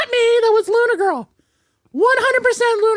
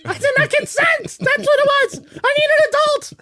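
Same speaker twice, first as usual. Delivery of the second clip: higher-pitched, hammed-up protest; mock outrage; 8 semitones higher, 3 dB louder than usual. Joking to the co-host about being violated, mock outrage. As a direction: protest loudly as a mock victim.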